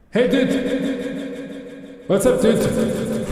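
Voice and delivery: high, slow, warped robotic voice; screwed-up audio effect. Retold or imitated. Mocking XQC's stream with an audio effect on, warped high slow voice. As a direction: sound like a broken voice changer. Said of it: "just like this".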